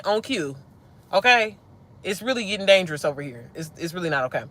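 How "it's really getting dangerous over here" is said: ordinary talk, nothing marked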